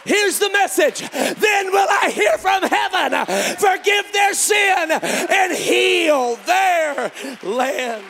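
shouted, high-pitched preaching with slow, stretched phrases; fiery revival preaching; full-force preaching. Acted. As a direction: preach it at the top of your lungs to the congregation.

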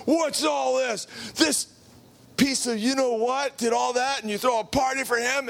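high, outraged demand; indignant older brother. Acted. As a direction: confront the father with indignation. Älterer Bruder empört.

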